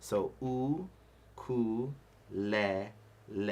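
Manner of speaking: "U, ku, le, le" each syllable apart with pauses; syllable breakdown. Shown as a demonstration. Teacher breaks ukulele down for the class. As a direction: break "U, ku, le, le" into slow separate syllables. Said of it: "if we break it down".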